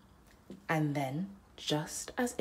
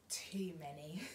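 whisper; 14 dB quieter than usual. Acted. Whispered answer to child.

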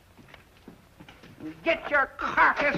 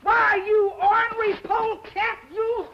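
angry outburst; 6 semitones higher than usual. Acted. Billy loses temper at stranger.

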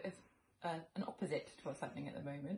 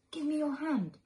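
put-on higher, louder child's request; eager little boy; 10 semitones higher, 11 dB louder than usual. Chester asking his mother for her hand, eager and higher. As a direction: ask like a grinning little boy.

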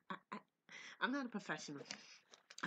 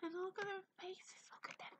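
higher, barely voiced dread; horrified whisper; 12 semitones higher, 5 dB quieter than usual. Real, not acted. Player staring at the robots' faces, horrified whisper. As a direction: hush it out in horror.

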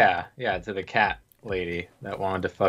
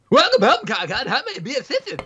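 high, laughing robot voice; 5 dB louder than usual. Pretend. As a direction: play a giddy house robot. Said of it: "you did Aaron Hansen's robot voice".

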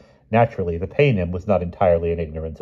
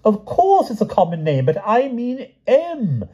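very high, rising frustrated cries; beginning to shout; 8 dB louder, slower than usual. A narrator's reading. Pellinore pressing his clue on the knights, shouting. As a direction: insist on the letter M, louder each time. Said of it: "beginning to shout".

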